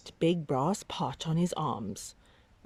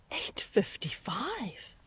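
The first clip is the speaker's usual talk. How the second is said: hushed punchline; 5 semitones higher, 5 dB quieter, slower than usual. A narrator's reading. Punchline, huge number.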